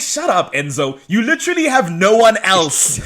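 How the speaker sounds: high-pitched mock scolding breaking into laughter; exasperated telling-off. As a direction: scold him with amused exasperation.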